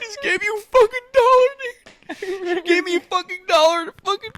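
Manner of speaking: hammed-up high kid voice, near crying; squeaky twelve-year-old voice. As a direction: squeak it out like a whiny preteen boy. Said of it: "how our voices sound".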